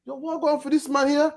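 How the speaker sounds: high-pitched bewildered fleeing criminal; confused criminal. Retold or imitated. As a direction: voice the runner's bafflement. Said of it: "looking like".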